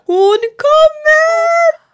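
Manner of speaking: long, high, loud wail; very high drawn-out scream; panicked birth cry. Retold or imitated. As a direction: yell it, drawing out each word. Acted shout during contraction.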